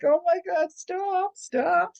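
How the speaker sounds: high, pleading mock protest; squealed stops, re-enacting a laughing fit; retold helpless laughter. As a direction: act out begging a dog to stop mid-giggle. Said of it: "I would be laughing, and I'm like".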